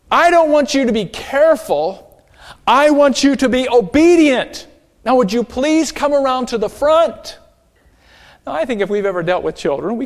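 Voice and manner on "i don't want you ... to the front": higher, louder firm parental scolding. Retold or imitated. Aretta lectures Jimmy about obedience, raised firm voice. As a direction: lay down the law to a child.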